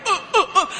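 mimicked stammer, stuck on "uh"; mock stuttering, unable to get a word out; imitated lock stutter. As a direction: repeat short blocked grunts as if stuttering. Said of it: "he would stutter and kinda like a lock stutter".

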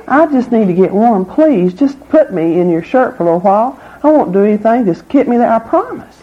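high, wheedling snake begging to be saved; pleading snake voice. Acted. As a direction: beg sweetly like a sly snake. Snake begs man for warmth.

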